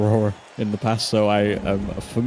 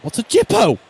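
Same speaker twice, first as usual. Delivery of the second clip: surprised; 12 semitones higher, 5 dB louder than usual. Real, not acted. Puzzled at slang.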